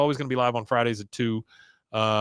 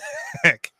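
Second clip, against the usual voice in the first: mock laughing shriek.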